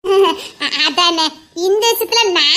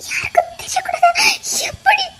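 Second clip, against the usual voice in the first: high, fast, breathless and tearful; frantic crying; 8 semitones higher than usual. Acted. Wife panicking at her husband, frantic and crying. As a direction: panic rising through the tears.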